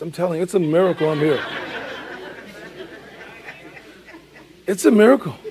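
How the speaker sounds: laughing. Real, not acted. Laughing about childhood dangers.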